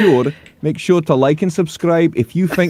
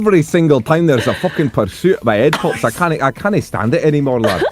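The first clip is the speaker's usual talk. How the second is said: hammed-up Scottish accent; mock Scottish accent; 3 semitones higher than usual. Scottish accent: Chase ranting about pursuits. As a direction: grumble like a fed-up Scot, Scottish accent. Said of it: "I'd be Scottish next time".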